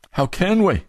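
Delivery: rising anguish on the repeat; How can we, cried high. Shown as a demonstration.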